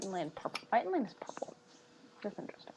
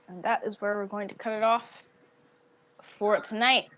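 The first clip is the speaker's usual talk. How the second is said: ordinary delivery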